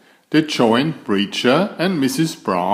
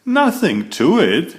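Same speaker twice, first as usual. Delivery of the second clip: with a grin; 7 semitones higher, 3 dB louder than usual. A narrator's reading. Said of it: "said Too Tall with a grin".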